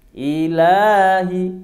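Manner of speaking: sway the long vowel gently; swung two-count vowel. Shown as a demonstration. Teacher shows the two-count long vowel on "Ilahi". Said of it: "dua harokat".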